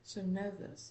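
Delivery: normal talking voice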